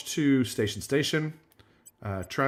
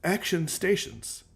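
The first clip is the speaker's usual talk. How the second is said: put-on command voice; 4 semitones higher, 4 dB quieter, much slower than usual. Another put-on try at command.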